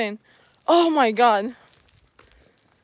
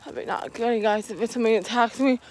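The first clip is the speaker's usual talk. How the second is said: quiet, quick scared murmur; scared hush; 5 semitones lower, 8 dB quieter, faster than usual. Real, not acted. Vlogger fearing an attack, quiet and quick. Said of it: "attack me from behind".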